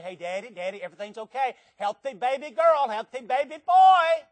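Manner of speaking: joyful doctor's news, building to a loud, high finish; excited announcement. Acted. Doctor bursting in to tell a waiting father of the birth, excited announcement.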